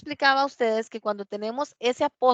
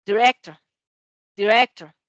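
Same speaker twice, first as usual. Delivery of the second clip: "director" punched loud on "rec"; loud model word "director", di-REC-tor; stress on second syllable; 9 dB louder than usual. Shown as a demonstration. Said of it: "el acento va aquí en esta sílaba".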